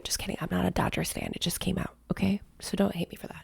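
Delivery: hushed, almost whispered confession; sheepish aside. Real, not acted. Host to viewers, owning up to a joke, sheepish hushed aside. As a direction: drop to a soft, low murmur.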